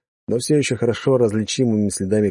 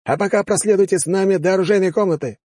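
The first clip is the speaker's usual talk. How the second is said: commanding officer; 10 semitones higher than usual. Acted. Officer orders the major.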